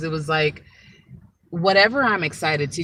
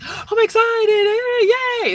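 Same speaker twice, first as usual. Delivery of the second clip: squealed very high and louder, slow and gushing; over-the-top excitement; 6 dB louder, slower than usual. Shown as a demonstration. Showing viewers what excitement is not, a mock gushing squeal. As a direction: play up giddy excitement like a cartoon. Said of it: "It's not that all the time".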